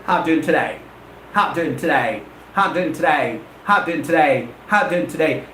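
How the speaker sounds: low, quick, linked-up phrase; run together as one word. Shown as a demonstration. Teacher demonstrating spoken English track, run together as one word. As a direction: blend it all into a single word. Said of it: "I treat them as one single word".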